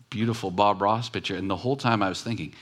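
ordinary talk

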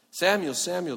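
calling out. Acted. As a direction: call him from afar, insistent. The Lord called to him.

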